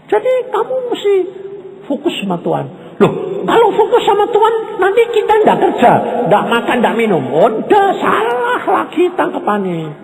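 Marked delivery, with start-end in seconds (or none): 3.0-7.2 high, fast voice of a doubter; preacher mocking a doubting listener; mock objector. 7.3-9.9 preacher correcting the congregation at full force; preaching shout. Real, not acted.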